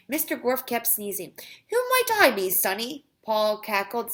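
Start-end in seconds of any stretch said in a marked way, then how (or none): 1.7-3.1 put-on high, loud old granny voice; cackling grandmother. A narrator's reading. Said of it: "He sounded like somebody's grandmother".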